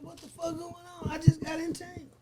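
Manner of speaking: high, thin, panicky disbelief; alarmed confusion. Retold or imitated. Pulled over by police, high panicky confusion. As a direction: squeak it out like police just lit you up.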